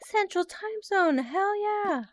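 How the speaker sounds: high, hyped fan cheer; excited chatter. Retold or imitated. Viewer cheering about her time zone, high and hyped. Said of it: "people come out of the tropes to say".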